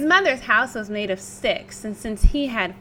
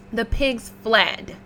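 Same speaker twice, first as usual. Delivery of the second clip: ordinary delivery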